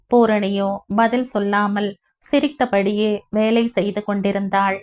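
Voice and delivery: ordinary delivery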